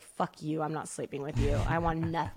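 low, soft grumble as a spurned dog; the dog turning its back on you, muttering; sulky dog voice. Pretend. Voicing the angry dog Bubs.

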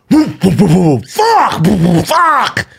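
mock crash yelps. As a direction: yelp each whoop as he loses control. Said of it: "Going, I hear".

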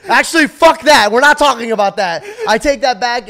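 loud, flustered backpedal; flustered outburst. Real, not acted. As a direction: blurt it out, laughing nervously. Flustered joking about pregnancy.

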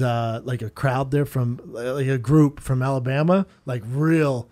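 usual speaking voice

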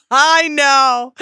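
high laughing "I know"; high, loud laughing agreement. Real, not acted.

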